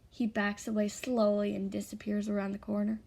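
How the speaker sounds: ordinary delivery, nothing marked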